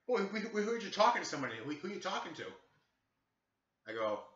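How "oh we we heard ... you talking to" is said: higher-pitched mock cop questioning; pushy mimicked officer; nosy second cop. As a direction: imitate a cop pouncing with questions. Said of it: "the other guy goes".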